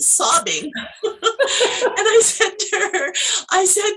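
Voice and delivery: high, loud laughter through the words; laughing. Real, not acted. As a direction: tell it through a real burst of laughter. Laughs telling of her sobbing.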